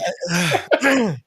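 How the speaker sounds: rough throat clear. Real, not acted. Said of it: "A fly set me off".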